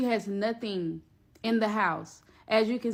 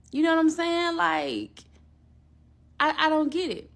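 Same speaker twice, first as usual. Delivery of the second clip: higher, louder baffled complaint; bewildered venting; exasperated; 6 semitones higher, 3 dB louder than usual. Real, not acted. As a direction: push it up in disbelief. Confused rant about her motives.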